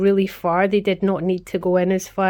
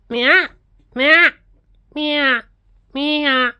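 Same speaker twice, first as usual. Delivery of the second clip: high, loud meows calling the cat; cat meow imitation; 7 semitones higher, 6 dB louder, much slower than usual. Shown as a demonstration. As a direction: call the cat with its own meow. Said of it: "Wait, no, it's meow".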